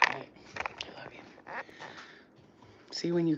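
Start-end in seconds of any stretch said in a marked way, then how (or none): 0.1-1.2 whisper. Real, not acted. Whispered sign-off to viewers.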